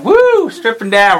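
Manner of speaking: high whooping cheer; excited whoop. Real, not acted. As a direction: whoop it up with glee. Cheering as wife strips.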